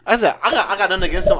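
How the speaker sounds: ordinary talk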